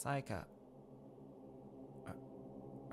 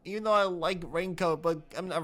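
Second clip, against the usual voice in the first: higher and louder, swearing in frustration; angry rant; 7 semitones higher, 4 dB louder than usual. Real, not acted. Player raging that the plot copies another game. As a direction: vent at the game, getting louder.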